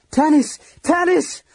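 very high, anguished cry; pitiful scream. A narrator's reading. Lorana calling to Tanis under the rubble, screaming. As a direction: scream the name in desperate grief. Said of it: "Lorana screamed, a terrifying, pitiful sound".

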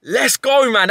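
triumphant cheer. Real, not acted. Celebrating the lucky catch.

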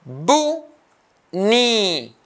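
"bunni" split into bu and ni, pause between; two isolated beats for "bunni", first one high; syllable by syllable. Shown as a demonstration. Teacher cutting the word into two, syllable by syllable. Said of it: "two syllables".

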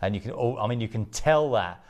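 home shopping host. Acted. Presents diamond ring slowly.

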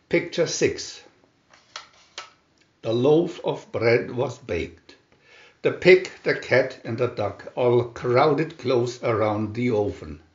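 plain reading voice

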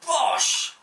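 high punchy bosh; 得意地喊; triumphant exclamation. Real, not acted. Moving game piece triumphantly.

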